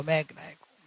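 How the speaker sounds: quiet, inward repetition; soft affirmation. Acted. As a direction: say it softly to yourself. Repeated inner affirmation, fading. (धीमे स्वर में आत्म-स्मरण)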